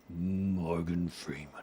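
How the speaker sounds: slow attempt at Morgan Freeman. Shown as a demonstration. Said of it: "I wish I had a deep Morgan Freeman voice".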